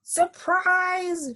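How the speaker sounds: high, drawn-out shout with falling end; shouted surprise. A narrator's reading. Amelia Bedelia joining the surprise, high drawn-out shout. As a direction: shout it high, then let it fall. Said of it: "shouted Amelia Bedelia".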